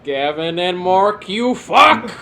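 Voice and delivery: loud hammed-up curse; mock angry shout. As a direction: yell it like a tantrum. Mock rant at designers.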